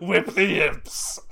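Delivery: slow, loud villain command; slow gruff order. Pretend. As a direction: bark it slowly like a tyrant. Voicing a character order.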